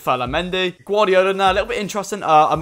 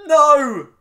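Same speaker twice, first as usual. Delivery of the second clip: anguished shout; 9 semitones higher, 6 dB louder than usual. Real, not acted. Conceding a goal.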